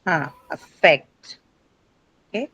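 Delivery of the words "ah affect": a-FFECT, weight on FFECT; slow demo of affect; stress on second syllable. Shown as a demonstration. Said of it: "ideally, affect has".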